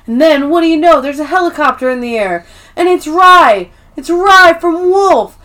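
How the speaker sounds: high, loud imitation of a hype man; hyped-up sales pitch. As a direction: announce a big arrival like an overexcited promoter. Ryan's hyped-up marketing pitch acted out.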